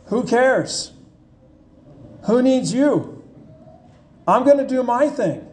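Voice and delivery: a hint of a sulky rebel saying who cares; defiant Isaiah. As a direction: play the stubborn rebel waving God off, lightly. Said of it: "And Isaiah says".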